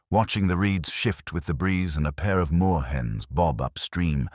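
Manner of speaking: ordinary delivery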